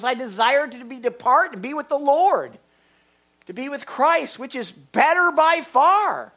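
high, loud rising declaration; fervent longing. Acted. Preacher voicing Paul yearning for Christ, fervent.

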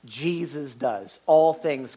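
normal talking voice

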